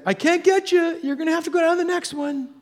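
high-pitched holler across water; imitated shouted warning; distant guide calling out. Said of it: "I hear this voice saying".

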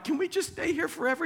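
very high, quick, eager plea; excited Peter. Acted. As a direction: gush it like an overexcited disciple. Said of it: "He's so excited, didn't know what to say".

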